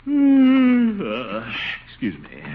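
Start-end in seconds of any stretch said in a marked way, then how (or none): 0.1-1.0 high strangled squeal mid-swallow; a sudden yelp that catches in the throat; choked squeak. Acted. Gildersleeve yelps as Adeline flirts over dinner, strangled squeak.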